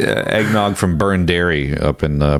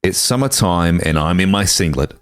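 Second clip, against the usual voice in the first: put-on Australian accent. Reading Aussie Jingle Bells to co-hosts, Australian accent. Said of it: "read it to you in an Australian accent".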